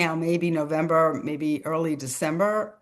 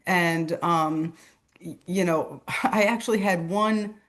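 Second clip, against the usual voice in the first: ordinary talk, nothing marked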